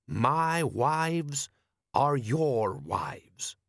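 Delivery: put-on slow, accented, ceremonious elder voice; desert wise man. A narrator's reading. Wise man answers his foreign guest, slow and stately. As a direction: intone it slowly as a foreign sage.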